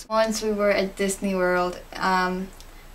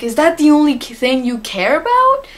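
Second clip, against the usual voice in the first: loud, higher indignant snap; indignant retort; 6 semitones higher, 7 dB louder than usual. Retold or imitated. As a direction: snap back at them in outrage. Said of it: "And I'm like".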